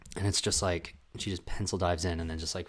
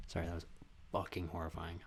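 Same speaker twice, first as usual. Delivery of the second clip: quiet apology; 5 semitones lower, 15 dB quieter, faster than usual. Real, not acted. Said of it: "that was terrible".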